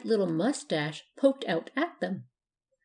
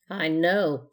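plain reading voice

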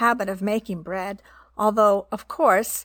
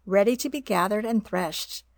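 ordinary delivery

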